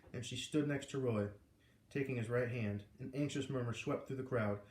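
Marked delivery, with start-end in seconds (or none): none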